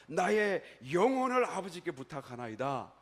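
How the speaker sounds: loud, slow quoted prayer; 부르짖는 기도 재연. Retold or imitated. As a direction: call it out slowly, re-enacting a last prayer. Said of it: "소리치면서 뭐라고 그러셨나요". (크고 느리게 부르짖는 기도)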